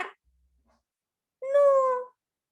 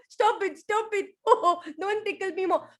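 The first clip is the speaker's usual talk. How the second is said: loud, high giggling pleas; laughing protest; 7 semitones higher, 8 dB louder than usual. Acted. Tickled elephant laughing and begging everyone to stop. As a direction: beg through laughter.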